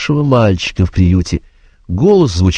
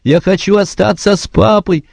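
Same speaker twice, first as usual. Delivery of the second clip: put-on a little boy close to tears; near tears; 11 semitones higher than usual. A narrator's reading. Joe begging the lieutenant to stay, near tears. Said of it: "Джо чуть не плакал".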